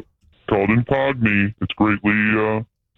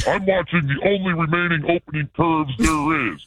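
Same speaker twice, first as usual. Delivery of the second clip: lightly disguised mystery caller; a hint of a shady phone caller; 6 semitones higher than usual.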